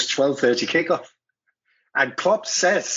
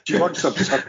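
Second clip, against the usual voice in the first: retold mumbled menace; mumbled threat; 4 semitones higher than usual. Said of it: "Klopp went".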